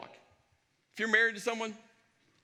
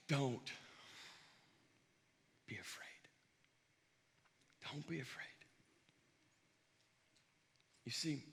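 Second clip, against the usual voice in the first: hushed sermon; 8 semitones lower, 12 dB quieter than usual. Acted. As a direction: draw them close, barely above a breath. Intimate sermon climax.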